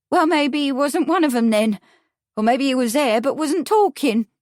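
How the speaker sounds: high, loud, uneducated witness voice; confused witness. A narrator's reading. Witness revising her story for the lawyer, confused.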